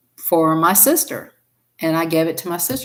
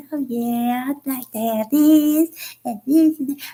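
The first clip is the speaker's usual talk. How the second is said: sister's voice; 7 semitones higher than usual. Retold or imitated. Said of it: "She said".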